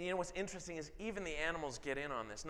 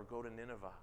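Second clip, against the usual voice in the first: "Go to Nineveh." said much softer, a quiet self-correction; 5 semitones lower, 10 dB quieter, faster than usual. Shown as a demonstration. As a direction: drop the voice right down on "Go to Nineveh.".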